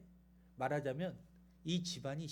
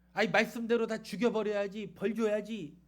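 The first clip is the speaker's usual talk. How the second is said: louder, higher indignant verdict; harsh insistence; 4 semitones higher, 8 dB louder than usual. Retold or imitated. Jonah argues they must all be punished, indignant. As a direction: demand punishment loudly, in mock indignation.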